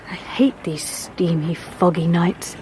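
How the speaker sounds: slow, breathy whisper of distaste. Acted. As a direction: drag it out under your breath. Hushed talk waiting in fog.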